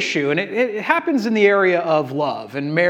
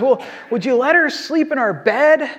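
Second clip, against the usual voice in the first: wife; 8 semitones higher than usual. Retold or imitated. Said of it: "She goes".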